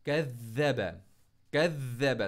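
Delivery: कज़्ज़ब with a doubled ज़ pressed hard; stressed shadda. Shown as a demonstration. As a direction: put real force on the ज़ in कज़्ज़ब. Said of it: "बहुत ज़ोर लगाना है इस पर". (कज़्ज़ब में ज़ पर पूरा ज़ोर)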